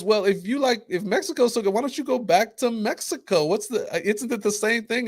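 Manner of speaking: high, pointed mock retort; imitating the women. An Indian woman throwing the ranter's words back, high and pointed. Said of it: "And one says".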